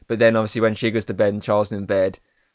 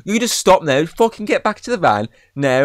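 higher, commanding mock shout; mock yell; 8 semitones higher than usual. Reviewer voicing Royce yelling at Logan, a mock shout. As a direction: bark the order like an angry boss. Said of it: "starts yelling at him".

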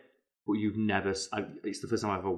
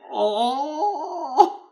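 stunned drawn-out oh; 6 dB louder than usual. Real, not acted. Mock stunned reaction.

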